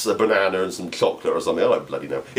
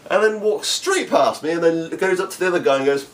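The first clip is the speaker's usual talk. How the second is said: usual speaking voice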